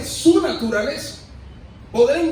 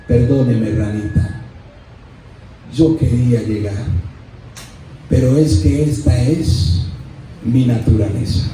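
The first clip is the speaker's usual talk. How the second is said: low scorpion apology; 10 semitones lower than usual. Acted. Scorpion apologizing to the dying frog, deep and low.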